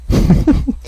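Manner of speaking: quick real laugh, high; a brief burst of laughter at himself; short high laugh. Real, not acted. Laughing at his own gross confession, short high laugh.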